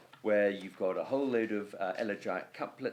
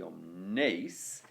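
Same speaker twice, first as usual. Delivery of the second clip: "omnes" leaning on a long "nes"; om-NES, following the meter; weight on last syllable; 5 semitones lower than usual. Shown as a demonstration. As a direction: shift the weight to the end of "omnes". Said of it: "put some weight on the first syllable of the foot".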